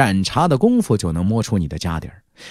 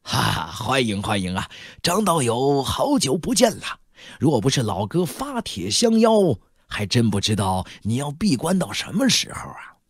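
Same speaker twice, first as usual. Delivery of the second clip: portly middle-aged host's hearty greeting; enthusiastic welcome; 4 dB quieter than usual. Acted. Middle-aged shop manager greeting a long-absent guest, enthusiastic. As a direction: welcome an old acquaintance effusively. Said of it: "热情地说道".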